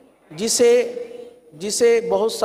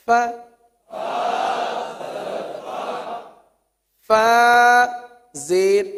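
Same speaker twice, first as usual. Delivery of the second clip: recitation drill; 2 semitones higher, much slower than usual. Shown as a demonstration. Teacher chanting fa drill for students.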